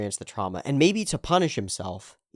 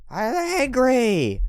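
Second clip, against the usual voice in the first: slow angry shout; 12 semitones higher, 4 dB louder, much slower than usual. Retold or imitated. Roommate admitting anger, drawn out.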